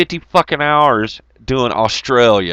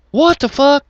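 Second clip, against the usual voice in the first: mock shocked outburst; 4 dB louder than usual. Imagined outburst.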